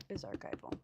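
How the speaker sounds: talking through hiccups. Real, not acted. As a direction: hiccup interrupting the words. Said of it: "got the hiccups".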